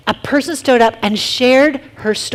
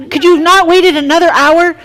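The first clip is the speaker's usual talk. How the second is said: whiny complaint; 7 semitones higher, 5 dB louder than usual. Retold or imitated. Child protesting early pickup.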